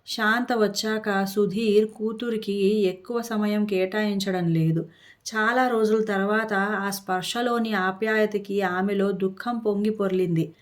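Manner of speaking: ordinary delivery, nothing marked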